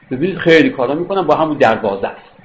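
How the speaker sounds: normal talking voice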